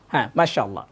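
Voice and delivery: ordinary talk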